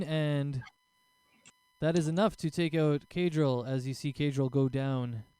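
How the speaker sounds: ordinary delivery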